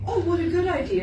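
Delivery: high, quick, chirpy dog voice; a cheerful dog agreeing happily; playful dog voice, laughing through it; dog's voice. Retold or imitated. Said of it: "they cock their head and they say".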